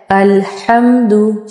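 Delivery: अल्हम्दु slowly, breath before the ha; a careful, drawn-out अल्हम्दु; slow model word. Shown as a demonstration. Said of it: "word है वो है".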